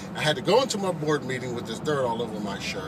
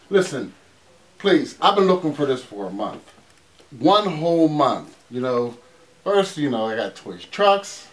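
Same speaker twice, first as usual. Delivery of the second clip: frustrated rant; 4 semitones higher, 5 dB louder than usual. Acted. Frustrated man grabs gift.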